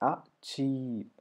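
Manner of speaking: Achieve as ah... cheee, long ee; syllable by syllable. Shown as a demonstration. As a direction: split "Achieve" and hold its ee sound. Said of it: "ഈ sound വരുന്നുണ്ടോ".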